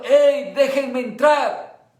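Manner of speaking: loud call, slow on entrar; Jesus calling loudly to the church to let him in; shout to be let in. Acted. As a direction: yell it at the closed door. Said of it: "sino gritando".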